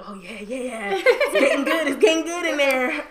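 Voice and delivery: laughing, high and loud delight; gleeful cooking voice. Retold or imitated. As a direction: savor it like a proud cook. Acted self checking the pot.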